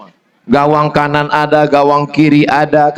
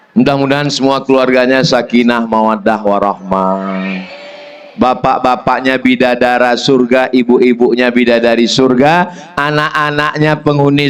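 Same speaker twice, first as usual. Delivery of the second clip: prayerful blessing; 3 semitones lower than usual. Acted. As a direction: let the blessing fade out.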